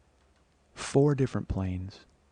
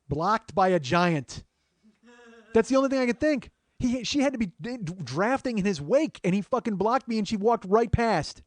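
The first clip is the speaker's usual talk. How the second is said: exasperated realization; 12 semitones higher than usual. Retold or imitated. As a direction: stretch the key words high in exasperation.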